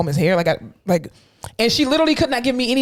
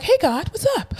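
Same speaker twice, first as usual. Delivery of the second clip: mock sweet greeting; 12 semitones higher than usual. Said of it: "You know, like".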